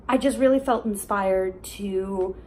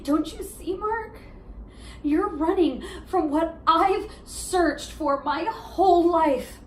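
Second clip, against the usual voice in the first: higher, slow, impassioned appeal; desperate plea; 5 semitones higher than usual. Acted. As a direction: beg an ex to understand, stretching the key words. Monologue, pleading with ex-boyfriend.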